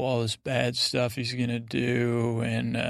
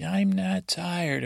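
higher, drawn-out imitation of a sulky king; mock petulant protest; 6 semitones higher, slower than usual. Boy king protesting being sent to his chambers, mock petulant. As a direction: imitate a petulant refusal, stretched and raised.

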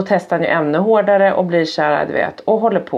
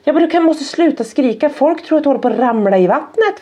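higher-pitched retold parental plea; exasperated scolding; 8 semitones higher than usual. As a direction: re-enact pleading with a screaming child. Acting out scolding her screaming son.

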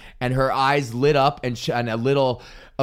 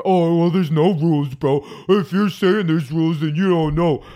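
know-it-all comic; 3 semitones higher, 4 dB louder than usual. Retold or imitated. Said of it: "I used to be that guy".

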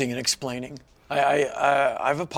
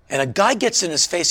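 usual speaking voice

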